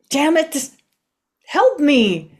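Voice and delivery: loud, panicked cry for help; frustrated shout. Acted. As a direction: yell it out in frustration. Can't hold the gun.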